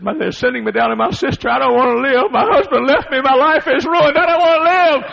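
high, loud, rising despair; desperate wailing. Retold or imitated. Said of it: "I never heard a voice quite like this".

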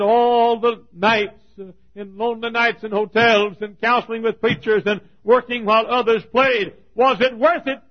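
loud, high preaching, stretched on the first words; preaching at full force. Acted. Preacher to his congregation, retelling his mother's question, preaching loudly. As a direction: thunder it out to the pews.

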